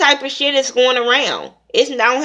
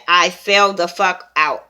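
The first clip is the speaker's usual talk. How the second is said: normal talking voice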